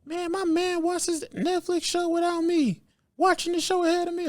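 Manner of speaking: squeaky complaining coworker voice; upset woman voice. Retold or imitated. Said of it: "women at my job getting mad".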